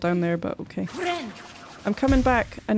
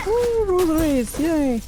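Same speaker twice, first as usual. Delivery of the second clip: excited; 9 semitones higher, 4 dB louder than usual. Real, not acted. Delighted at finding item.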